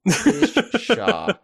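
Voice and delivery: high, laughing pshaw; laughing scoff. Acted. Mock dismissive scoff.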